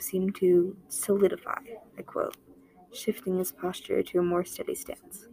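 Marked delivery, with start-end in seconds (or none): none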